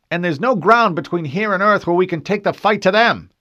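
high, loud, heated protest; angry outburst. A narrator's reading. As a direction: throw it out angrily at full voice. Marine's angry outburst in the quarrel.

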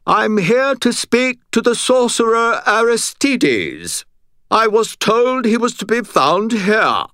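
very high, loud, drawn-out British nobleman; called-out British accent. A narrator's reading. Butters calling for the sorcerer Aristides, obnoxious British accent. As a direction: call across a big room in obnoxious British. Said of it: "and then called out".

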